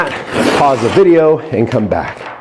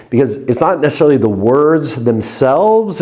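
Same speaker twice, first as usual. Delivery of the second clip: ordinary delivery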